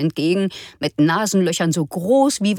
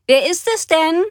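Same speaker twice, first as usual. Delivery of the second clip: higher, louder call; calling from kitchen; 11 semitones higher, 5 dB louder than usual. Acted. Mama calling from the kitchen, higher and louder. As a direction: call it out from another room. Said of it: "rief Mama aus der Küche".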